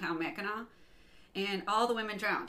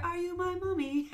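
put-on high, eerie English kid voice; English child; 10 semitones higher than usual. Host imitating child heard on the phone, English child voice. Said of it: "you'll pick it up, and you hear".

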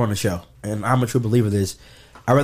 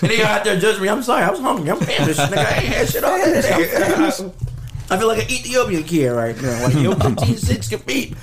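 high, giggly excuses; laughing; 8 semitones higher than usual. Real, not acted. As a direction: laugh through every word. Laughing excuses while eating.

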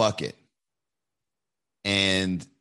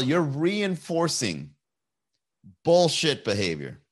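slow emphatic; 3 semitones higher, slower than usual. Real, not acted. Rant at complainers.

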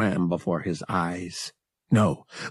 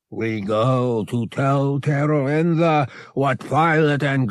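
slow, higher, growling creature voice; threatening Togorian beast; 5 semitones higher, slower than usual. A narrator's reading. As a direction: order the captive about in a slow growl. Merg orders his captive around.

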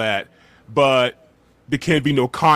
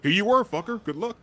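sarcastic; 8 semitones higher than usual. Retold or imitated. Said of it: "it's like".

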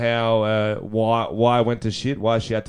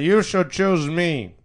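slow, raised-pitch politician parody; weirdo politician; 6 semitones higher, much slower than usual. Acted. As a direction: drag it out like an awkward candidate. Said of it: "Can't you just picture a politician though?".

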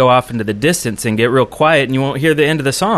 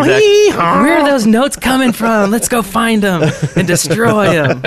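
excited high mock alarm; mock outraged; 10 semitones higher than usual. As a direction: play it up like a confused victim. Mock hunting the trolling source.

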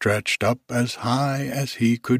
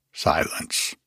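plain reading voice

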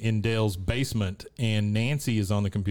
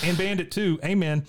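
put-on high kid voice adds to prayer; mock Bobby; 9 semitones higher than usual. Bobby adds to the family prayer, high kid voice. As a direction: pipe up like a little boy. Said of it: "Bobby says".

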